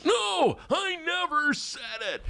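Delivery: high, mocking denial; mock Bernie denial. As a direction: parody his denial. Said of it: "put it right to Bernie".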